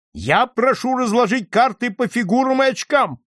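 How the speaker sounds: high, insistent call across a crowd; shouted demand. A narrator's reading. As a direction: cry it out as a demand. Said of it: "выкрикнул тот же офицер".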